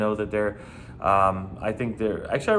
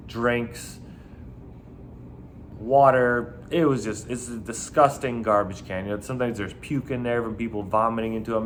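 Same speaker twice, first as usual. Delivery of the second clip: usual speaking voice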